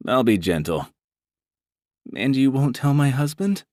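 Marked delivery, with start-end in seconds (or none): 2.1-3.6 a hint of a higher nervous woman; lightly voiced woman. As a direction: say it as a hesitant client. Brandy anxious.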